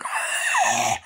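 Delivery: very high breathy shriek; mock shriek. Reviewer acts out a piercing shriek during the stabbing. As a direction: squeal it like a stabbing scream.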